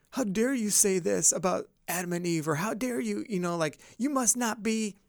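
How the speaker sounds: put-on righteous indignation; mock outrage. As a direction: act out someone affronted by another view. Acting out an outraged reaction.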